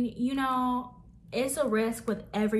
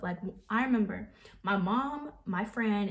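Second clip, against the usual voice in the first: ordinary delivery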